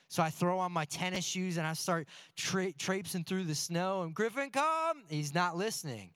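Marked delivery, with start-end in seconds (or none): 4.1-5.0 pet-owner calling tone. Retold or imitated. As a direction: call the dog in from the yard. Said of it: "a certain tone when we call for our animals".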